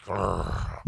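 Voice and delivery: low deflating groan; deflated growl. Retold or imitated. Teammates seeing the door shut, deflated growl. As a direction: let out a soft, low grr. Sound of their sails deflating.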